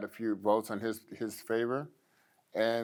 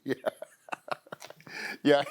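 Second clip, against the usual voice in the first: laughing; 4 semitones higher than usual. Real, not acted. Laughing at the prompt.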